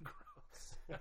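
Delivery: a high, breathy laugh into "gross"; laughing in disgust. Real, not acted. Co-host laughing at gross eyelid pox story. Reacting to gross chicken styes.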